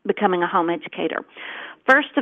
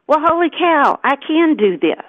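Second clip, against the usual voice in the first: excited realization; 8 semitones higher than usual. Retold or imitated. Mother realizing she can homeschool, excited and pitched higher. Sudden realization it's possible.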